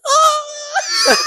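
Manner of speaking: high squealing scream; mock shriek. Friend acting out the girl's startled high scream.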